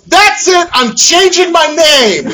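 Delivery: a dad at his wit's end blowing up; exasperated outburst. Retold or imitated. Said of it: "Finally, I go".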